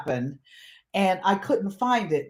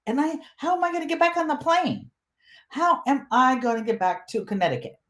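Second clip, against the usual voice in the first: high, fast, rising panic; panicked traveler without ID; mock panic; 5 semitones higher, faster than usual. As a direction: rattle it off in a rush, worried. Panicking over missing ID.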